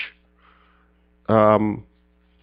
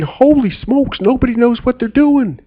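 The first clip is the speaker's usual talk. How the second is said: a higher, startled thought; alarmed realization; 10 semitones higher than usual. Retold or imitated. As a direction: blurt it out in mild panic.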